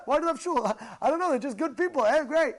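high, lively quoted chat; animated exchange. Retold or imitated. Newcomer explains why he loves shul, animated.

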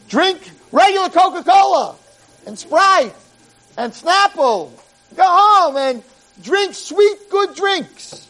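rally the crowd to celebrate; joyful urging. Retold or imitated. Prophet's joyful command.

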